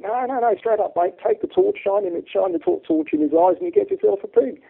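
high, insistent Aussie mate; Australian accent. Acted. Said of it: "kill me for this Australian accent".

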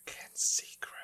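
breathy stage whisper of the title. Acted. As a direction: whisper the title like a secret cue. Whispered cue to co-host.

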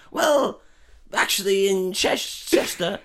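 high, loud, rising into a squeal; a pompous toff losing his temper; indignant posh rant. Pretend. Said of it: "And you're like".

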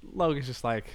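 normal talking voice

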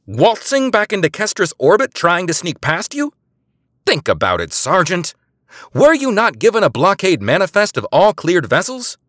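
high, loud scornful question; mocking scorn. A narrator's reading. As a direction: scoff at his ignorance. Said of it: "Eliza guffawed".

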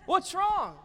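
high, loud sudden question; concerned owl. Acted. Wise owl asks a crying eaglet, high and loud. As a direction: call down to the crying youngster.

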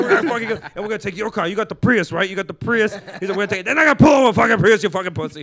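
loud, high Diaz rant; comic ranting loudly as Diaz in the club; mock loud rant. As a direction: hammer the plan out loudly. Acted Diaz riff.

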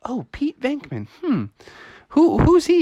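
high, puzzled musing; curious neighbors. Acted. Neighbors ask mommy who Venkman is, curious. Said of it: "They're like".

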